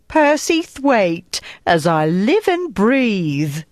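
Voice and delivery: drawn-out, high Cockney greeting with genteel affectations; elocution-trained Cockney. A narrator's reading. Woman greets old friend in pub, affected Cockney, delighted. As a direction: stretch it out like a Cockney putting on refinement. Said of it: "sounded like a Cockney who had been to elocution lessons".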